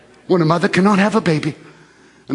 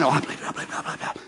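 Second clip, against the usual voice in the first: very soft, rapid unvoiced mumble; kept in the mind; 3 semitones lower, 10 dB quieter, much faster than usual. Preacher mocks silently confessing faith in your mind, muttered. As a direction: mumble it under your breath, barely audible. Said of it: "keep it in your mind".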